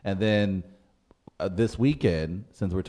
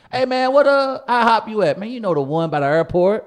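much higher, louder local street voice; St. Louis slang voice; 12 semitones higher, 7 dB louder than usual. Retold or imitated. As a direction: play up the St. Louis hometown talk. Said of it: "That's basically how we describe places".

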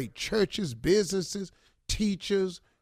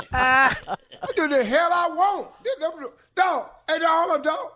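indignant neighbor; 9 semitones higher than usual. Acted. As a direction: holler down the complainer. Acting loud neighbor rejecting complaint.

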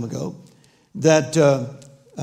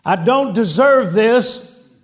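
raised, slowed, higher cry of protest; loud outburst; 6 semitones higher, 6 dB louder, slower than usual. Retold or imitated. Pastor protesting to God in a loud voice. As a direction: blurt it out loud and slow, pitched up. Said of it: "I heard myself say in a loud voice".

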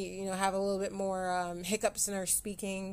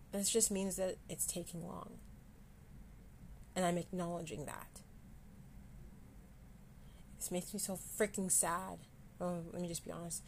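choked up and much softer; holding back real tears; quiet tearful voice fading away; real crying; 9 dB quieter than usual. Real, not acted. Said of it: "I just had a good cry".

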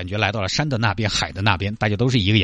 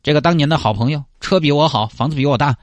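usual speaking voice

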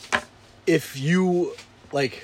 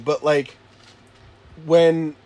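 ordinary delivery

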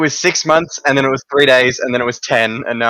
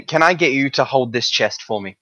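usual speaking voice

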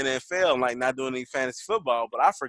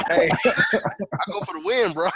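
laughing breathy words through a chuckle; 5 semitones higher, 3 dB louder, slower than usual. Real, not acted. Laughing while defending himself to his old friend over the jersey. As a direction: laugh it out, half-defending yourself.

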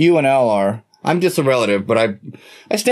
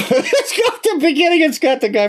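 high-pitched, breaking into laughter; laughing; 10 semitones higher than usual. Real, not acted. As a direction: laugh right through the words. Laughs trying to recall the song.

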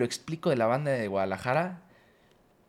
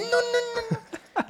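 much higher laughing protest; wife laughing no; slower than usual. Retold or imitated. Quoting his wife begging him to stop, laughing. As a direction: laugh out a mock plea to stop. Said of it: "lo hago y me dice".

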